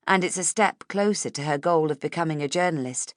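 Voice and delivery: plain reading voice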